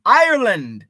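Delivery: loud, high, hammed-up Irish accent; terrible Irish accent. Shown as a demonstration. Host echoing a viewer's Irish-born dad, loud bad Irish accent. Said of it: "That's my terrible Irish accent".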